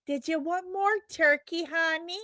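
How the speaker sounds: high-pitched put-on voice of a Thanksgiving host; mimicked local host. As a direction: pitch it way up, mock-doting.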